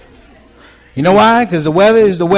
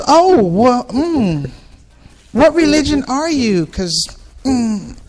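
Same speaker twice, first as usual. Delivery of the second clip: higher, slow prying lady voice; probing lady; 8 semitones higher, slower than usual. Retold or imitated. Lady fishing for his religion, high prying voice. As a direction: ask it carefully, slowly.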